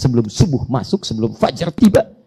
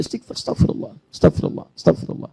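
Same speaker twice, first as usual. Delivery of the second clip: whispered istighfar; 3 semitones higher, 3 dB quieter than usual. Shown as a demonstration. Preacher showing congregation how to whisper istighfar. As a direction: whisper astagfirullah softly. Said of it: "hadirkan, hadirkan".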